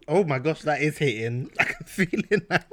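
ordinary delivery

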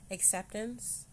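ordinary talk

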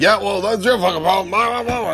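high dismissive coworker voice; rude coworker. Retold or imitated.

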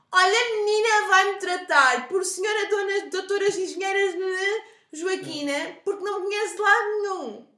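high, loud, slow haughty customer; snooty customer. Retold or imitated. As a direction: mimic a haughty customer demanding titles. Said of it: "pessoas que a seguir dizem". (cliente arrogante e exigente)

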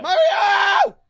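loud call across the room; shouting a name. Acted. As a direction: yell the name out. Calling out to the cook.

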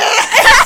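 a high, loud laughing outburst; loud burst of laughter. Real, not acted. Laughing at the hot breath story, loud high laugh. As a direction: crack up hard, high and loud.

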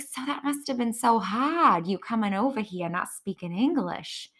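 a hint of a mimicked girlfriend; Brittany. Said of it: "But she was like".

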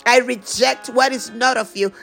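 ordinary talk, nothing marked

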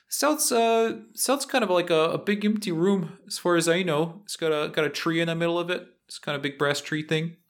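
high-pitched, casual henchman voice; hideout henchman. Acted.

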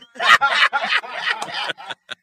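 big burst of laughter; loud laugh. Real, not acted. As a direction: laugh hard at your own joke. Own joke.

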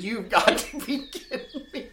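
high-pitched retold scolding through laughter; laughing mock disbelief. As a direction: retell your disbelief while cracking up.

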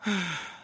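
long heavy audible sigh; demonstrated sigh. Shown as a demonstration. Preacher showing the congregation what the sigh sounds like, weary sigh. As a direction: let out a slow, weary sigh. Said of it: "Let me do it one more time".

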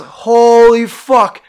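loud, slow, drawn-out gasp of disbelief; retold shocked exclamation; stunned horror. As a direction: stretch it out high and loud, appalled. Sees the open casket.

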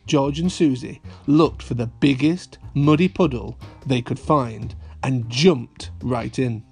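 plain reading voice

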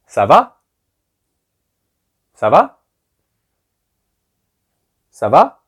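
"ça va?" with va rising sharply at the end; question tune on ça va. Shown as a demonstration. Teacher models the informal French greeting, "va" rising as a question. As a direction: lift "va" high, as a question. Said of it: "you have to go up at the end".

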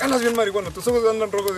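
high, loud mocking scold; an accusing mother; scolding mom. Mom scolds her son about red eyes, loud accusation.

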